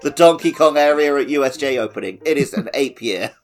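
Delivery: ordinary delivery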